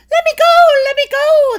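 very high, loud squeaky shout; shouting leprechaun. A narrator's reading. Caught leprechaun shouting to be released, very high and loud. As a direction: shriek to be set free. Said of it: "the leprechaun shouted".

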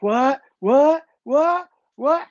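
imitating excited onlookers; hyped crowd. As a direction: mimic a crowd going wild, each one climbing. Crowd reacting to the battle move.

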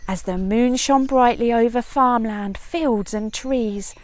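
usual speaking voice

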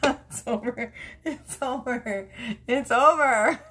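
giggly, building to a loud finish; cracking up, increasingly emphatic; laughing surrender. Real, not acted. Giggling, mock-declaring the reading finished.

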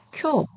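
쿄 short and high with a puff; aspirated 쿄. Shown as a demonstration. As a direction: release the k of 쿄 with air. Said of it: "이렇게 읽어주세요". (거센소리로 짧고 높게 쿄)

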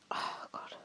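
breathy whispered groan; whispered aside. Real, not acted. Host whispering to herself before a long email. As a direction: whisper it under the breath, dreading the task.

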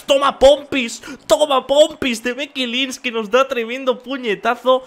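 loud, high, gleeful shout; excited shout. Real, not acted. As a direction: yell it out as the hit lands. Taunt turned into a hit.

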